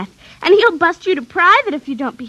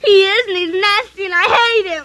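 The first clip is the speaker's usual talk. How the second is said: tearful outburst; 4 semitones higher than usual. Acted. Girl upset about her dog.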